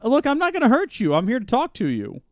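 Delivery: calm her down, she's terrified; reassuring. Real, not acted. Player soothing the frightened old woman, reassuring.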